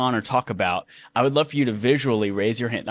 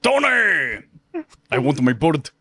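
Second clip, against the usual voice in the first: lightly voiced villain quotes, slow at the end; a hint of a movie villain doing the big line; MCU impressions; slower than usual. Host doing Andy Cortez's Marvel impressions. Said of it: "Andy Cortez MCU impressions".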